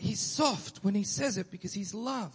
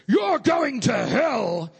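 slow, loud, higher hellfire yell; fire-and-brimstone street preacher bellowing; soapbox preacher shout; 7 semitones higher, 8 dB louder, much slower than usual. Retold or imitated. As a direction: thunder it from a soapbox. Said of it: "many years of soapbox".

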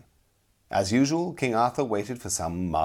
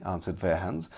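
ordinary delivery

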